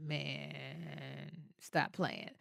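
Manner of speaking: very soft, low imitation of an unamused man; barely voiced, low retort; quiet disbelief. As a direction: say it low, under your breath. Date's unamused look, voiced.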